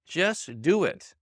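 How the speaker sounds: drawn out very slowly, each word weighted: "Just do it"; slow "Just do it" right down, every word weighted; slow emphatic. Shown as a demonstration. Coach repeating to doubters, very slowly.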